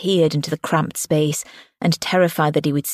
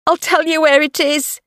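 high-pitched desperate offer; frightened mum's cry; 11 semitones higher than usual. A narrator's reading. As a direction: plead in a high woman's voice. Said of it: "her mum cried".

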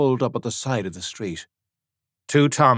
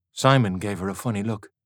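plain reading voice

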